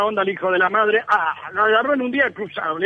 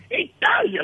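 Italia, scoffed much higher; scornful repeat; 14 semitones higher, much slower than usual. Acted. Barber scoffing at the client's trip, high and scornful. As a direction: sneer the word Italia, pitched high.

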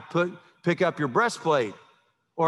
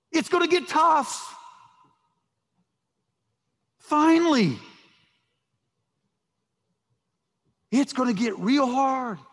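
urgent proclaiming; 10 semitones higher than usual. Acted. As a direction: proclaim it like a battle warning. Preacher voicing Paul's urgent warning.

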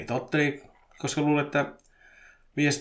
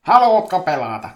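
loud, higher acted invitation; kova kysymys; 5 semitones higher, 11 dB louder than usual. A narrator's reading. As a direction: call out the question loudly. Acted line of story character. (kovaääninen, korkea kutsu)

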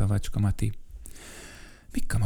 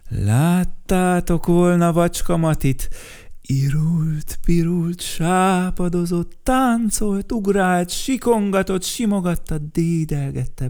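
much higher and louder, rising with joy; excited building list; 8 semitones higher, 4 dB louder than usual. A narrator's reading. (egyre izgatottabb felsorolás)

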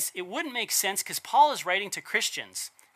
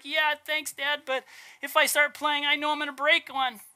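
put-on higher kid voice, moping; gloomy kid; 8 semitones higher than usual. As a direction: play the pessimist boy sulking over his toys, voiced up high. Gloomy pessimist son answers dad.

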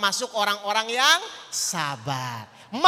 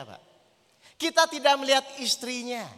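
ordinary talk

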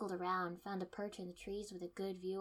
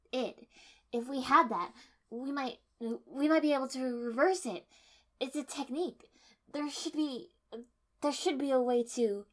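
raised, pressing voice working out a plan; urgent plea; 5 semitones higher, 8 dB louder than usual. A narrator's reading. The heroine urging her team, raised and urgent. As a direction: push the idea through urgently. Narrator voicing Shikako urgently.

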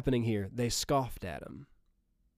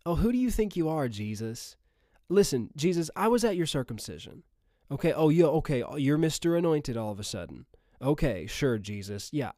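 mock scoffing townsperson, quick jabs. Townsperson to Jesus who grew up among them, scoffing. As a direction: imitate dismissive scoffers putting him in his place. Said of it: "That's scoffing".

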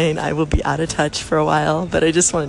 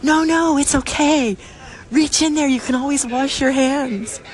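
much higher, bright coaxing reassurance; encouraging; 8 semitones higher than usual. Retold or imitated. [name] urging the girl next door, reassuring. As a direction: egg her on kindly through the stall wall. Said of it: "I go".

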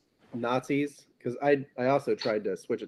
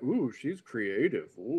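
slow leering imitation; imitating the creepy guy; 5 dB quieter, slower than usual. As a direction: drawl it out like a creep. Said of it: "That guy is such a perf. He's like".

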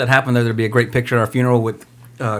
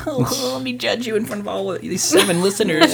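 mock scolding wife voice; 9 semitones higher, 3 dB quieter than usual. Mocking wife's judging tone.

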